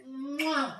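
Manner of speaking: high smacked "mwah" kiss; chef's kiss. Real, not acted. Cook praising their own calzone, chef's kiss.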